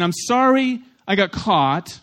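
slow, high sorry with attitude; insincere apology. Acted. Pastor mimics someone sorry they got caught, slow and insincere. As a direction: drag it out high, a mock apology. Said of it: "You can say sorry with an attitude".

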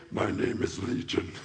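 very low, rasping demon voice. Acted. Demon telling Jesus its name, low hammed-up demon voice. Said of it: "I'm gonna use my demon voice".

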